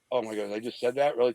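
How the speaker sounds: low, quiet self-rebuke; hushed retold realization; dismayed aside. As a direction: say it under your breath as the blunder sinks in. Realizing his blunder to himself.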